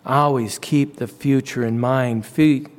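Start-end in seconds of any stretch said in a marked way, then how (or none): none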